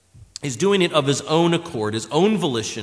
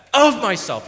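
OF MYSELF punched out higher; great emphasis; 5 semitones higher, 4 dB louder than usual. Retold or imitated. Preacher showing the emphasis of Jesus's words, emphatic stress on "Of myself". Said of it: "with great emphasis in the original language".

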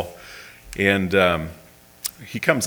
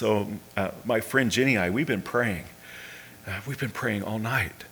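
put-on hushed, understated voice of a troubled girl; mild sorrow, voiced softly; softly imitated distress; 7 dB quieter than usual. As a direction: retell her plea quietly, without sobs. Weeping girl explains her trouble.

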